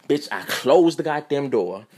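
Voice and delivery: normal talking voice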